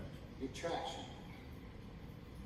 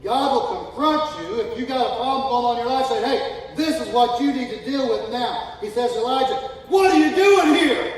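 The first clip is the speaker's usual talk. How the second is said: full-force preaching; 10 semitones higher, 16 dB louder than usual. Acted. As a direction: preach it at the top of your voice.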